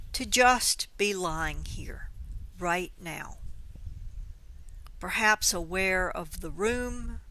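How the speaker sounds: unhurried meditation voice with long pauses; slow, spacious body-scan guidance; guided meditation. Acted. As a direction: lead them gently into awareness, leaving space between phrases.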